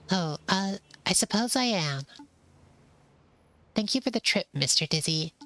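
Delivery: a timid mouse thanking the pigeon politely; polite little mouse. Acted. Voices polite mouse thanking Dizzy.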